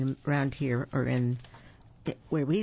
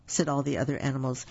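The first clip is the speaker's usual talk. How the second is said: ordinary delivery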